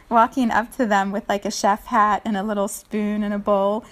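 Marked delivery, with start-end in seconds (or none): none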